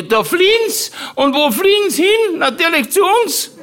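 put-on very high, indignant old aunt with laughter; Tante Mari. Aunt complaining about refugees, high and indignant. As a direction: squeak it out like an outraged old aunt. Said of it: "weil die Tante Mari gesagt hatte".

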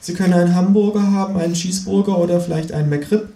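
a hint of a bored fast-food boy; cashier boy.

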